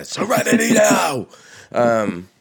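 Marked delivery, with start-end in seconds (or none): 0.0-1.3 high, loud mock outburst of a catchphrase; mock shout. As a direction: shout it like a stressed man losing it. Quoting Seinfeld catchphrase shout.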